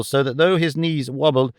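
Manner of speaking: plain reading voice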